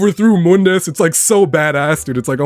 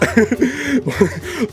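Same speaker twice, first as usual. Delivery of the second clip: laugh; 7 semitones higher, 4 dB quieter than usual. Real, not acted. Laughing at own Sonic voice.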